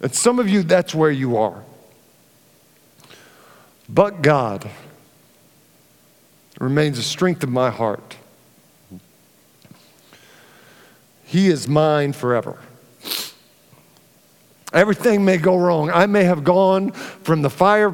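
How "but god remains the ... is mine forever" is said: tender scripture reading. Acted. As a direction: let each word settle gently. Reads psalm verse slowly.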